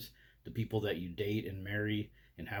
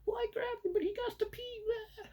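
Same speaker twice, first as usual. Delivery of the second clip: squeaky falsetto mock argument; bickering husband and wife. Husband and wife fighting over loading dogs, mock falsetto bickering. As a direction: squabble in an absurdly high imitation voice. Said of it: "the husband and wife are like".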